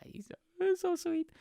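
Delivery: very high, squeaky coo; mock-gush in a tiny high voice; imitated gushing dad. Dad reacting to the girlfriend's kiss, squeaky imitated gushing.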